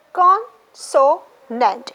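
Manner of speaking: very slow "consonant": con, so, nant; "consonant" broken by pauses into three beats; three separate syllables. Shown as a demonstration. Teacher counting syllables for the class, split apart. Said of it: "three syllables".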